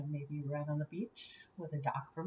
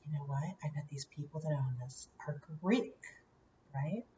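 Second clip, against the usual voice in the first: softer, hushed retold aside; 5 semitones lower, 5 dB quieter than usual. Mom spotting Greek icons, hushed confiding aside. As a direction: lower the voice as if sharing a guess.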